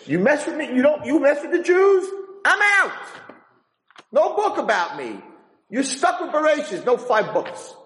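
high-pitched acted ultimatum; mock threatening. Teacher retelling Moses threatening God, high-pitched threat. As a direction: threaten in a voice pitched far above your own. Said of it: "he threatened him, he said".

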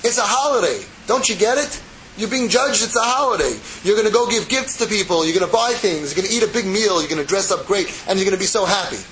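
excited reassuring prophet; animated prophet. Retold or imitated. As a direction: stop them from crying, cheerfully insistent. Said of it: "he gets up and he says".

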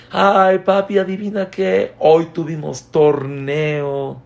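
put-on high, loud child's voice, eager; little kid voice, slow and excited. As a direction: burst in like a kid bursting with news. Child telling father his day.

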